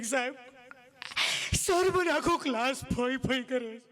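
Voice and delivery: slow, put-on tearful girl voice; whiny schoolgirl. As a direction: whine to the teacher like a crying girl. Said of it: "છોકરી સાહેબ પાસે રોતી રોતી કહે". (રડતી છોકરીનો ધીમો અવાજ)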